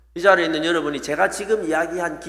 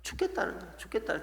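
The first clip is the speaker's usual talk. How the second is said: muttered complaint; 14 dB quieter than usual. Shown as a demonstration. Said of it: "입만 열면".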